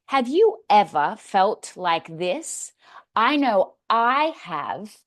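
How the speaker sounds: slow, emphatic audience hook; engaging storytelling question. Shown as a demonstration. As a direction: draw the audience in with weighty, drawn-out words. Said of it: "Tell a story and say".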